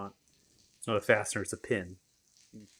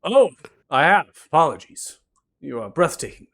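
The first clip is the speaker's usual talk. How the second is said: higher, louder flirting bard; flirty bard voice; 6 semitones higher, 14 dB louder than usual. Acted. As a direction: flatter them in a high showy voice.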